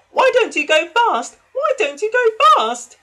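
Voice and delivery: very high, loud anxious squeal; two worried carriages urging on; anxious call. A narrator's reading. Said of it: "called Annie and Clarabel anxiously".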